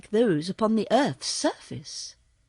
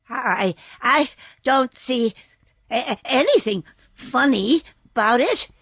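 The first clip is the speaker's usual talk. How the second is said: stammering; 3 semitones higher, 4 dB louder than usual. Acted. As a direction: stumble over the first words in fright. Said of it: "he stammered".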